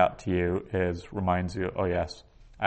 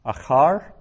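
Achar with CHAR stressed, higher and louder; stress on final syllable; 6 semitones higher, 8 dB louder than usual. Shown as a demonstration. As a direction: punch the last syllable of Achar. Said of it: "so that's accented".

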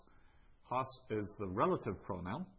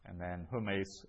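normal talking voice

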